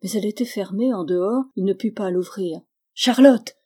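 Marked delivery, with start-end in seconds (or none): 2.9-3.5 loud, high call across the house; shouting a name. A narrator's reading. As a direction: call out desperately for Charlotte. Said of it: "cria-t-il". (crier Charlotte à pleine voix)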